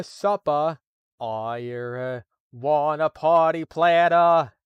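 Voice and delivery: loud, slow Boston Kennedy drawl; JFK impression. Warming into the Kennedy voice, a loud slow Boston accent. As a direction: belt it like JFK ordering chowder. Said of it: "For my John F Kennedy voice".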